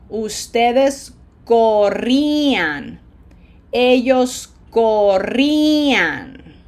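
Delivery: corría drawn out slowly, loud held stress on the í; slow, deliberate model of corría with a long "ee"; stressed syllable drill. Shown as a demonstration. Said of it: "Pronunciation emphasis highlighter".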